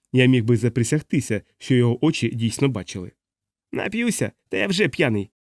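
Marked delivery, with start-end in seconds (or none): 3.7-5.3 contemptuous. A narrator's reading. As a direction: scoff back at the warning. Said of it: "з презирством відповів чоловік". (п'яне презирство)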